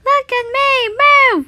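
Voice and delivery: high, loud showing-off yell; gleeful loud cheer; excited shout. Acted. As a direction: shout to make them watch her. Showing off in the costume.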